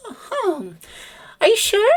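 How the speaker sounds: very high, chirpy bird voice; high chirpy bird lady voice; pleasant bird lady. Acted. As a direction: chirp it like a bored bird receptionist. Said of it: "And she says".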